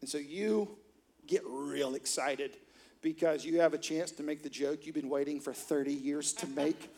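ordinary talk

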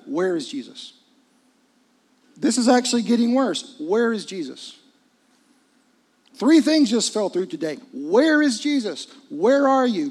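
loud rising preacher's plea; building preaching. Acted. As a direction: preach it rising toward a cry. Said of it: "preaching building".

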